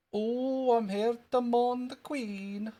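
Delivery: high, drawn-out Scottish schoolboy voice; squeaky little Scottish kid, stretching the words; Jimmy Krankie. Acted. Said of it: "Apologies for that Scottish accent".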